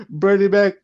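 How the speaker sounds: ordinary delivery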